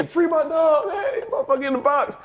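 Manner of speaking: high, laughing chant; teammates laughing. Retold or imitated.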